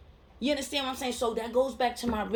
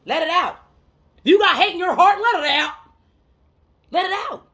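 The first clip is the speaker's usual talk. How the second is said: loud, high exhortation; raised-voice motivational push; impassioned urging; 7 semitones higher, 9 dB louder than usual. Real, not acted. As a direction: urge them hard, like a rallying cry. Urges listeners to release their feelings.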